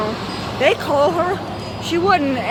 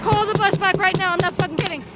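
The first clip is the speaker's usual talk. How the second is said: higher, furious insistence; angry demand; 5 semitones higher, faster than usual. Retold or imitated. Student demanding the office call the bus back. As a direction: bark the order, dead serious.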